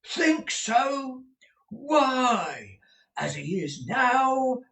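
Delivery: booming stagey manager asking; Mr Crummles the manager. A narrator's reading. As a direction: play the bluff old actor-manager.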